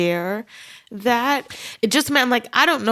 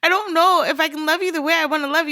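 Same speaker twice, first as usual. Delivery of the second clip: a light mock fluster, higher, rushed and laughing; overwhelmed friend; 9 semitones higher, faster than usual. Imagined reply to a new friend, flustered and rushed. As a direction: quickly apologize to a new friend, half-laughing. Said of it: "I'm like".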